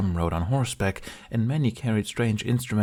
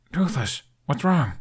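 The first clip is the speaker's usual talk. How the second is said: higher, worried voice; startled question; 7 semitones higher than usual. Acted. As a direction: ask in alarm. Hiccup startled by growling dragon.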